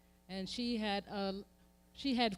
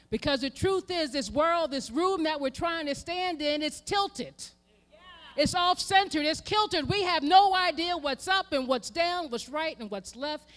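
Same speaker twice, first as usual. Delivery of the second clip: high, loud preaching crescendo; a rising sermon climax; preaching at full force; 7 semitones higher, 6 dB louder than usual. Acted. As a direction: build to a fervent peak.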